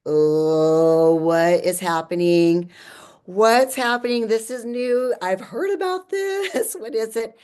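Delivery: loud, rising alarm mixed with a laugh; excited surprise. Retold or imitated. Retelling the ceremony to Matt, in loud startled excitement. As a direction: thrown by something brand new and half delighted. Retelling sudden rush of energy.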